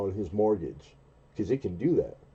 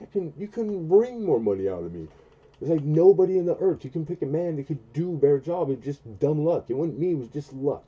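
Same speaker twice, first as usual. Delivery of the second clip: raised, high insistence it was luck; mock protest; 8 semitones higher, 5 dB louder than usual. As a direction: push back at the boss. Retold protest to boss.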